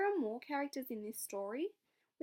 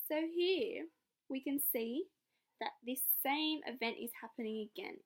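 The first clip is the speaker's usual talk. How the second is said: ordinary talk, nothing marked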